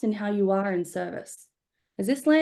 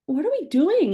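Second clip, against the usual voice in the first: exasperated; 7 semitones higher, 4 dB louder than usual. Retold or imitated. Retold despair at breakfast.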